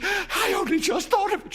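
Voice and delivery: high, loud, half-laughing hysteria; hysterical. A narrator's reading. Wife realising the wishes, high and hysterical. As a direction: gabble it out, near hysterics. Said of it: "she said hysterically".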